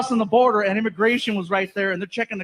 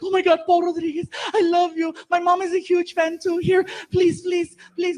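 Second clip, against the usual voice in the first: high, begging fan voice; higher pleading girl voice; 8 semitones higher than usual. Retold or imitated.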